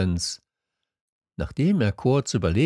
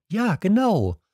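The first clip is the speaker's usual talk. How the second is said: put-on higher, childlike story voice; little prince; 12 semitones higher, 4 dB louder than usual. A narrator's reading. Little prince confiding in the fox, higher voice. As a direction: voice a small prince, pitched up.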